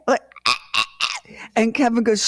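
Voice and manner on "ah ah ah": short, low strangled "ah" bursts; imitated choking gasps. Mother answers daughter's "are you okay", choking gasps. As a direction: act out choking in staccato airless gasps. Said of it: "I literally choked".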